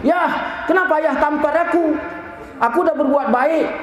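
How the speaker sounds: put-on high, indignant child voice; mock hurt outcry, high pitch; protesting son. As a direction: imitate a wronged boy challenging his father. Slapped son protests to father.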